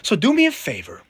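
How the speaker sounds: mock sweet demand. Interrogator sweetly pressures Ben to reread the tweet. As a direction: ask with menacing sweetness.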